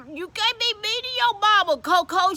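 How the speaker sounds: high, pleading reproach; scolding. Acted. Deacon telling Coco to treat mama right, scolding. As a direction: admonish as if setting someone straight.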